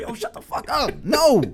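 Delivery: high-pitched flustered comeback; mock exasperation. Man exposed on the red carpet, mock exasperated retort. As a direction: shut it down in comic embarrassment. Embarrassed man's retort, acted.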